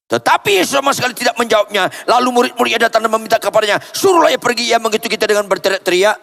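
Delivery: high, fast, urgent preacher's reading; impassioned rapid-fire sermon delivery; forceful scripture reading. Real, not acted. Reads verse at full force.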